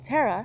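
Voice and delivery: "Tara" said as TERR-uh; different-name pronunciation. Shown as a demonstration. Showing accusers the different name, emphatic TERR-uh pronunciation. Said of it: "It's a completely different name".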